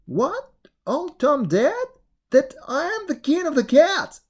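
high, loud triumphant cry; cat shrieking his new title. Acted. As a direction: shriek it out like a cat claiming the throne. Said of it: "Tom shrieked out".

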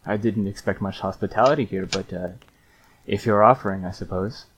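a hint of a wry old man; wry old monk. Old monk answers the offer of jerky, lightly voiced and wry. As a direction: accept it with a dry shrug. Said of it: "raises his eyebrows at this and goes".

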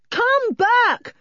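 put-on high, loud boy's yell; loud urgent call. A narrator's reading. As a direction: yell after someone running away. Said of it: "yelled Julian".

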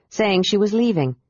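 ordinary delivery

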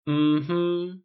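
high knowing hum; knowing mm-hmm. Acted. Stylist humming knowingly at Tony. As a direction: say you can't fool me. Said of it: "murmured in that tone".

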